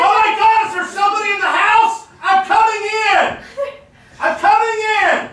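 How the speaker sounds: from another room. Pretend. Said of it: "That's why I'm yelling".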